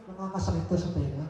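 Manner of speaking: fast, light quoted gripe; quick retold complaint. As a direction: rattle it off like her complaint. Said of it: "Sabi niya".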